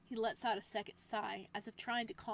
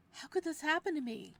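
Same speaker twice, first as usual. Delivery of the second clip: high, loud despairing cry; 7 semitones higher, 10 dB louder than usual. Acted. Captive despairs alone.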